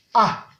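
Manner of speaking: A short, dropping to a low tone. Shown as a demonstration. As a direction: drop A briefly to the bottom. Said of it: "Ton bas". (« A » au ton bas)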